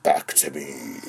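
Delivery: low villain impression, lightly done; imitating film villain. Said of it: "his voice is so iconic".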